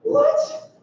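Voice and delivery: very high-pitched incredulous squeak; high, baffled yelp of a question; mock disbelief. Mock disbelief at God's people fearing.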